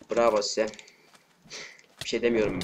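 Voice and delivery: usual speaking voice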